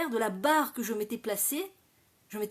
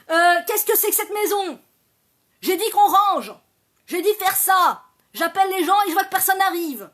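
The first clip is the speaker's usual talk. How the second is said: shrill furious yelling at the household; wicked stepmother; 7 semitones higher, 11 dB louder than usual. Acted. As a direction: scream at the kids over the mess. Mother yelling at family at home.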